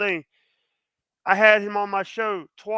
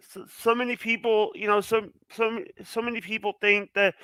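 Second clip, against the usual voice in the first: halting, stuttering false starts; real stammer, restarting with pauses; nervous stutter. Real, not acted. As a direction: stumble and repeat the opening words. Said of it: "sorry, I'm stuttering".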